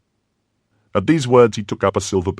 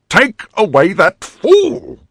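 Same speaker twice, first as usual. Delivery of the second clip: slow, high, loud scornful command; contemptuous order; 14 semitones higher, 8 dB louder, much slower than usual. A narrator's reading. The Cardinal ordering the fool taken away, scornful. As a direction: spit out the dismissal slowly.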